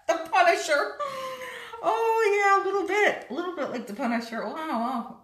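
lips covered by wet sheet; restricted lip movement; talking through a face mask. Real, not acted. Seen 1: Woman's lips stay covered by the sheet mask until she frees them.

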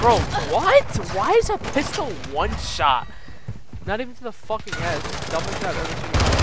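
loud disbelieving rant; furious at the game; outraged gamer. Real, not acted. Outraged at one-shot pistol.

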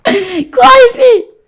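high crying yell to run; panicked yelling. Real, not acted. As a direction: scream at your friend to flee. Fleeing pigs, yelling at friend.

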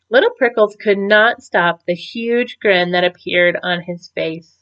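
ordinary delivery

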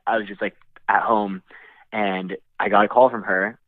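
ordinary delivery

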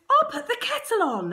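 high, breezy mum announcing tea. Retold or imitated. Mum walking in during labour, cheerful and high. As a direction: chirp it like nothing's wrong. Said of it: "she goes".

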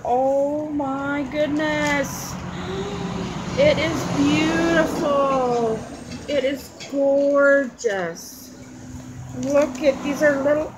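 slow, stretched-out words of delight; awed admiration. Real, not acted. Admiring Bobby Joe's pocket letter, awed delight. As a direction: savour each word in admiration.

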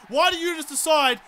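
ordinary talk, nothing marked

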